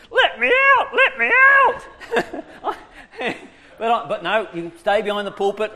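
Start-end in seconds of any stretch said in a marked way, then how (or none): 0.1-1.9 squeaky high shouted little voice; legs crying out. Retold or imitated. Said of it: "my legs are going like this". 1.9-3.5 breathy high laugh; burst of laughter. Real, not acted. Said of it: "laughs at own joke".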